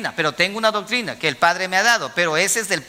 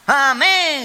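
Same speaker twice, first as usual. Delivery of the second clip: higher mock congregation shout; grito de la iglesia imitado; 7 semitones higher, 4 dB louder than usual. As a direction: mimic a church crying out amen. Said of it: "grita la iglesia". (grito imitado de congregación, más agudo)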